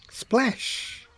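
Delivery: high breathy splash; splash sound. A narrator's reading. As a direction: imitate water splashing. Ball lands in bucket.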